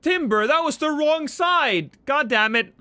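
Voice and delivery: high, loud exasperated yell; frustrated shout. Real, not acted. Gamer yelling at a teammate's bad throw, frustrated shout. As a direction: blurt it out as the throw goes wrong. Teammate threw it wrong side.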